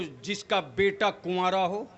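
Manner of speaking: ordinary talk, nothing marked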